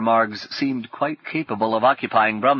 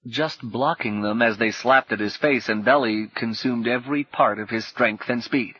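ordinary delivery

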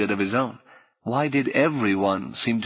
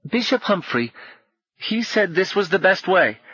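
put-on high, firm child voice; 9 semitones higher than usual. A narrator's reading. Boy tells Paul the bishop's advice, firmly. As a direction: state it with certainty. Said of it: "the boy spoke firmly".

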